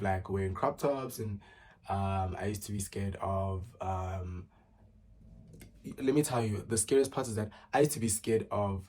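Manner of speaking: usual speaking voice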